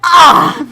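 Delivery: exasperated high-pitched "ah" at full volume; mock-anguished cry; overwhelmed yell; loud high wail. Groan at own sentimental meltdown.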